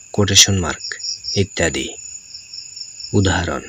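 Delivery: ordinary talk, nothing marked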